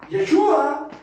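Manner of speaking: "Yeshua" loud and high, as others say it; "Yeshua" without the final-A accent, punched loudly; mispronounced name. Shown as a demonstration. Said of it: "Y así lo mencionan".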